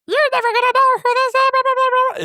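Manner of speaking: very high, fast squeaky chipmunk voice; tiny helium chipmunk voice; chipmunk voice changer. Retold or imitated. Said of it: "but it sounds like".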